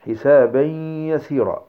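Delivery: "حسابا يسيرا" slowly, as "hisabayyy-yasira"; merge into yaa. Shown as a demonstration. Teacher giving another yaa example, merged with ghunnah. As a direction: hold a long nasal yaa between "حسابا" and "يسيرا". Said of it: "هذا فيما اختص بالياء".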